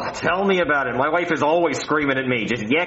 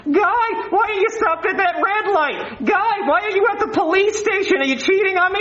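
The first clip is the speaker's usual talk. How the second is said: nagging wife. Retold or imitated. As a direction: mimic a jealous nagging wife. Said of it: "She's like".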